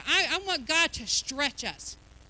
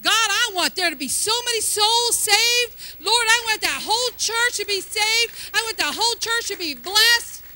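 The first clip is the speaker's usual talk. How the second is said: loud, high, impassioned demand; fervent preacher's shout; bold prayer; 7 semitones higher, 7 dB louder than usual. Shown as a demonstration. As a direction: cry it out to God with full force. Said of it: "We gotta start saying".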